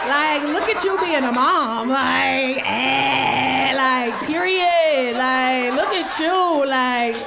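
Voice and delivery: slow, stretched-out mocking imitation; extra daughter. Daughter sassing her mom, hammed-up and extra. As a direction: drag out every word, over the top. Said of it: "she talks so extra".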